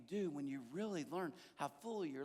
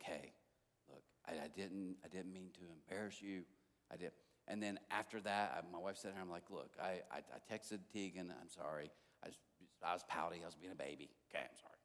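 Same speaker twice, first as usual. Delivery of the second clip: sheepish apology; 13 semitones lower, 9 dB quieter than usual. Retold or imitated. Apology text retold, lower softer.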